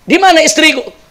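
a man shocked to see a stranger climb out; startled. Retold or imitated. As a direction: jump back in shock. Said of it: "Kaget ini orang".

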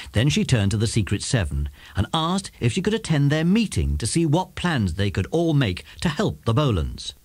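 ordinary delivery, nothing marked

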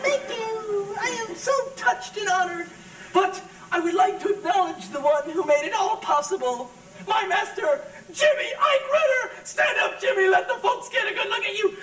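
very high, projected speech to a crowd; gushing acceptance speech. Acted. Climber thanking the crowd and his master, gushing speech. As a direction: call it out high as if from a podium.

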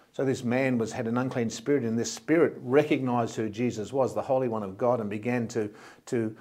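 usual speaking voice

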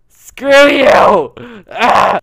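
real gamer rage; furious shout. Real, not acted. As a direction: blast it at the screen. Raging at the game.

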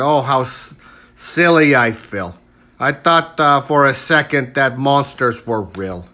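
ordinary delivery, nothing marked

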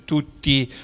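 ordinary delivery

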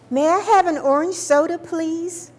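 put-on high, eager child asking very politely; a small child minding her manners at the counter; polite little girl. Little girl asks the lunch-counter woman, high and polite. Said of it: "I said very politely".

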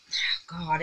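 ordinary talk, nothing marked

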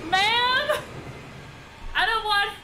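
overplayed tearful protest; hammed-up crying. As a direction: melodramatic sob, way up high. Character cries to mother in game.

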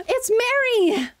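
gasp, then a high, loud cry of her name; thrilled to spot her at last; excited surprise. Real, not acted. Streamer spots Mary arriving in the arena, gasping surprise. Boss Mary appears on screen.

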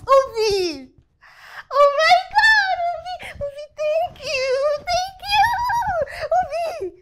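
high, loud, tearful thanks; overjoyed squealing. Acted. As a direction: gush thanks in squeals close to crying.

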